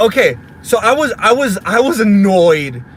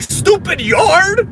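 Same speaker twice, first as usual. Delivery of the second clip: high, loud, drawn-out yell; mad outburst; 12 semitones higher, 5 dB louder, much slower than usual. Retold or imitated. Parent raging at the yard, mock-furious. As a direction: yell it in exaggerated frustration, dragging it out. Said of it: "I was mad. I was like".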